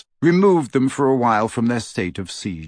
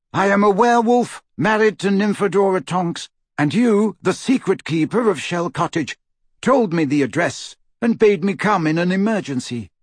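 high, urgent raised voice; calling over wind; 8 semitones higher than usual. A narrator's reading. Lupin proving who he is through the door, calling urgently. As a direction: call out your credentials over the wind. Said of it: "called a voice over the howling wind".

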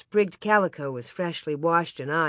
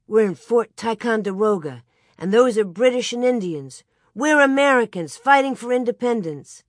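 rousing make-believe declaration, building louder and higher; pretending child; 4 semitones higher, 6 dB louder than usual. A narrator's reading. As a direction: proclaim it like a child playing soldiers. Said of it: "Laura pretended".

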